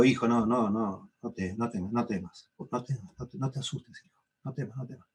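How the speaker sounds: susurro tierno a un hijo que llora, cada vez más suave; padre consolando bajito. Retold or imitated. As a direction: calma al niño en voz casi apagada. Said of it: "Y yo le digo". (padre que calma de noche a su hijo que llora, en un susurro tierno)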